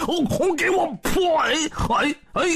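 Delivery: high yell into puzzled yelps; battle yell. A narrator's reading. Zhu Bajie strikes from behind and misses, high yell. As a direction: yell the strike, then break off in short cries.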